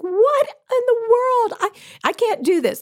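disbelieving cry, pitched high; shocked disbelief. Retold or imitated. Retold panic at speaking.

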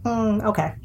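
ordinary talk

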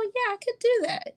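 high, eager patient voice; imitating a patient. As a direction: chirp it like a willing patient. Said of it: "And they'll go".

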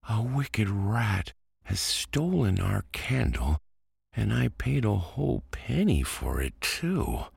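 put-on high, upset little boy. A narrator's reading. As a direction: near tears about the candle. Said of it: "cried he".

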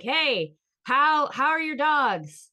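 bright, high, loud small-talk voice; forced cheerful question. Retold or imitated. To her silent family, forced cheery question. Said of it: "One word answer".

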